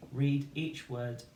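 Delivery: slow and deliberate; slow teacher instruction. Shown as a demonstration. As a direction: spell out the instruction slowly. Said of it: "to read the word".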